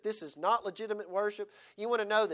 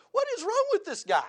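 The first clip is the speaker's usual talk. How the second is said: put-on high, loud puzzled child voice; like a bewildered little kid asking; children's voice; 7 dB louder than usual. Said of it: "All the little children are going".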